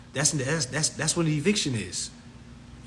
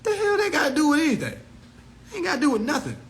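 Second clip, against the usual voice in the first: much higher, exasperated disbelief; high incredulous rant; 10 semitones higher, faster than usual. Retold or imitated. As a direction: throw up your hands in disbelief. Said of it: "I'm like".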